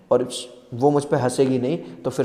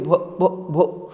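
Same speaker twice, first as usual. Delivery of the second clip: stammering; 2 semitones higher, much slower than usual. Acted. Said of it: "बेचारी हकलाती है".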